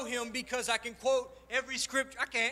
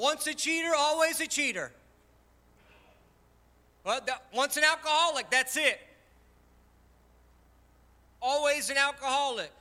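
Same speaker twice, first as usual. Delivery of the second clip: mocking accusers; 3 semitones higher, 4 dB louder than usual. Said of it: "I said this in our class".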